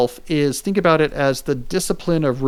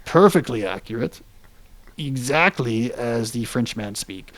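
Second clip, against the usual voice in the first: put-on pipe-smoking French accent; 4 semitones lower than usual. Host defends his French accent to co-host, mock French accent. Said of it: "good pipe-smoking French accent that we used".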